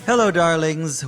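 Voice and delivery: breathy vintage purr. Acted. Hostess greeting in intro style.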